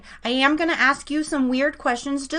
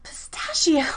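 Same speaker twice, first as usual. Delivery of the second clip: breathy disbelieving whisper; surprised whisper; 5 semitones higher than usual. Real, not acted. Host reacting to the guest's odd favorite flavor, surprised whisper.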